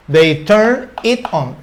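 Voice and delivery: slow, deliberate model phrase; slow teaching read. Shown as a demonstration. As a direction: lay out each word for the learners. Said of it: "puedo decir". (frase modelo lenta y deliberada)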